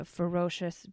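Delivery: ordinary talk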